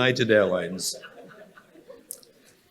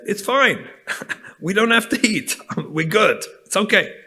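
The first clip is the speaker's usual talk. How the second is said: laughing it off; 7 semitones higher than usual. Retold or imitated. Laughing off the missing meal.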